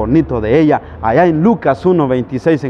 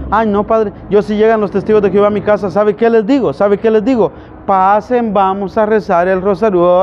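higher, quick parishioner voice; 5 semitones higher than usual. Retold or imitated. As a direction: pitch it up as a self-assured churchgoer. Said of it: "la otra católica que decía".

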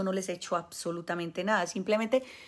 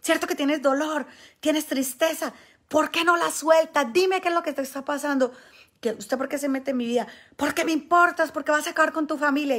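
higher and louder, pressing questions; hard confrontation; 7 semitones higher, 5 dB louder than usual. Retold or imitated. Exorcist confronting the possessed man, higher and louder. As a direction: interrogate him hard like an exorcist. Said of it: "lo confrontaba duro".

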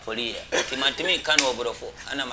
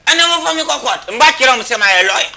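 much louder and higher, shouting over the host; heated loud protest; 11 semitones higher, 13 dB louder than usual. Real, not acted. Guest arguing back about evidence, heated shouting. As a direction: protest at the top of your voice.

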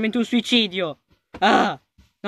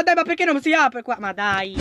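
ordinary delivery

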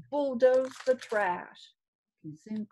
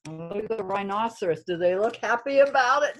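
ordinary delivery, nothing marked

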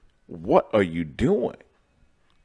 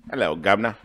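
mock British "governor" greeting; British accent; faster than usual. Vlogger testing a British accent on viewers, mock British. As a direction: put on a cheeky British accent. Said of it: "I need to work on my British accent".

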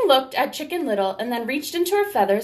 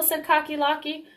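ordinary delivery, nothing marked

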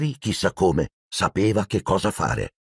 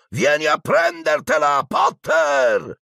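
much higher, shouted dare; taunting shout; slower than usual. Acted. Malfoy shouts a taunt down to Potter from the air. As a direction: yell the dare from the treetops.

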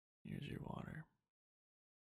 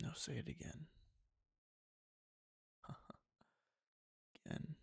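intimate whisper. Acted. Whispering to the listener, coaxing them to obey, teasing whisper. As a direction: lean in and whisper every word like a teasing captor.

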